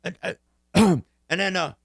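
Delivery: high, slow mock old-man voice with a throat clear; mocking Biden. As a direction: drag it out like a confused old politician clearing his throat. Mimicking Biden at debate.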